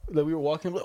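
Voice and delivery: normal talking voice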